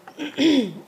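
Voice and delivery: loud rough throat clear. Real, not acted. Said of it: "I've got a sore throat".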